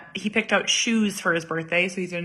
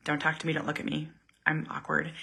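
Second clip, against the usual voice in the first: fast, softer shy mumble; awkward shy mumble; 4 semitones lower, 10 dB quieter, faster than usual. Retold or imitated. Shy mom avoiding the salesperson, mumbling fast. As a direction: mutter it, wanting to hide. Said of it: "Where I'm like".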